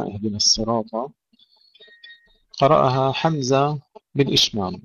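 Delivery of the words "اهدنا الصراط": normal talking voice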